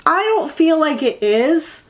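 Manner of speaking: ordinary talk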